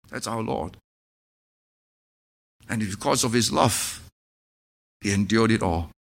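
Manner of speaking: low, soft, earnest preaching; intimate, solemn pulpit voice; hushed sermon. Acted. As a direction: draw the congregation in quietly.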